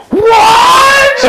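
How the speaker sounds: shrieked what. Retold or imitated. The wife hearing the news, shrieking in shock. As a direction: scream it in disbelief. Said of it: "she's like".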